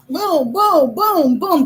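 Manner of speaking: high, swooping booms; imitated head bumps. Sister bumping while caught by vacuum, imitated thuds. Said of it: "My sister, like".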